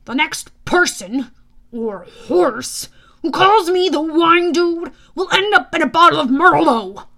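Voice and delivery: loud, exasperated threat; a fed-up god laying down the law; building from weary irritation to loud warning. Acted. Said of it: "Mister D sighed in exasperation".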